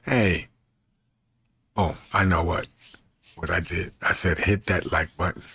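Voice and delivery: ordinary talk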